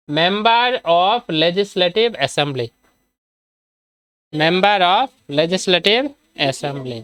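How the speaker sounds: very slow, word by word; slow dictation. Shown as a demonstration. Teacher giving students the full form of MLA, slow and deliberate. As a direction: spell out the full form with drawn-out words and gaps.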